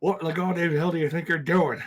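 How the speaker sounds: angry barfly with an overbite; loud, slurry bar brawler; belligerent drunk. Acted. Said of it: "That's me trying to talk with an overweight".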